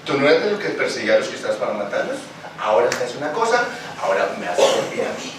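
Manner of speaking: más grave y rápida, terminando en susurro; un Señor que corrige con calma; voz del Señor imaginada. Retold or imitated. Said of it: "Yo me imagino al Señor diciéndole". (el Señor habla a Saulo, grave y suave)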